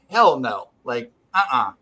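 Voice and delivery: ordinary talk, nothing marked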